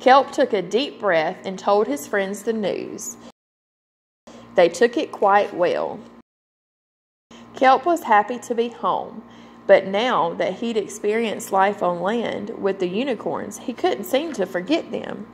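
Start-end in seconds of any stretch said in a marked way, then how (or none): none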